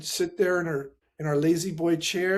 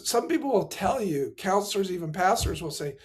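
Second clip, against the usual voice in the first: ordinary talk, nothing marked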